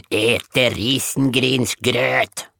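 very slow drawn-out demand for porridge; woodpile creature voice. Acted. The woodpile demanding rice porridge, very slowly. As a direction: stretch each syllable like a hungry beast.

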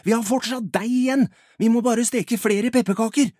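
loud, high, insistent plea; urgent shout. Acted. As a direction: keep yelling eagerly, pitched high. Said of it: "roper Albert".

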